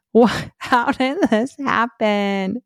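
slow, laughing disbelief at the mess; stunned disbelief. Retold or imitated. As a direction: ask it half laughing, half shocked.

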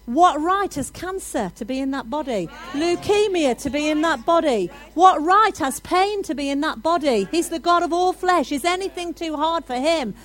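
preacher building to a loud climax; full-force preaching. Acted. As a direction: hammer each line home to the church.

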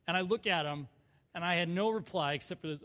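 ordinary delivery

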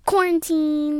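loud eager blurt; shouted answer. Real, not acted. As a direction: burst in with the word.

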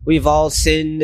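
ordinary talk

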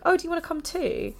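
high, sugary people-pleasing offer; overly sweet invite. Retold or imitated. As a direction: chirp it up high, too nice to say no.

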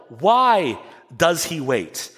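ordinary delivery

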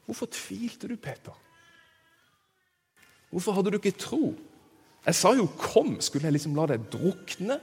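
soft, gently acted questioning; Jesus spør Peter. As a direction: ask it quietly of a doubting friend. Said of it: "så sier Jesus til Peter". (lavmælt, mildt spørrende)

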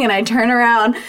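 normal talking voice

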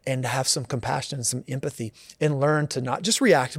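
normal talking voice

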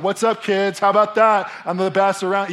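loud, high bragging; gloating. Pretend. Imagined dad taunting kids after winning, loud and gloating. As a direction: crow over the beaten kids. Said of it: "and, like".

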